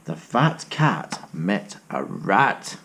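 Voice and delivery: slow, low read-aloud; low, slow storyteller; storybook narration. A narrator's reading. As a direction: savour each word for the children. Reading a picture book aloud.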